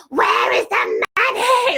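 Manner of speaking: high furious shout, drawn out slowly; screaming in rage. Retold or imitated. Yelling at the universe on a dark stormy beach, furious shout. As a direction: hurl it at the sky like a raging scream. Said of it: "screamed in rage".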